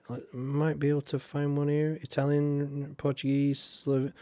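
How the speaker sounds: ordinary talk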